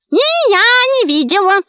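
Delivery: high witch's shriek; high-pitched, emphatic denial; shouted. A narrator's reading. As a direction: cry it out as a high-voiced witch. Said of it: "крикнула ведьма".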